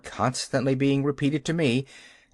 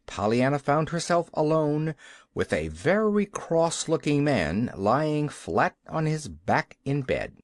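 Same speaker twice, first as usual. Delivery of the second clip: ordinary delivery, nothing marked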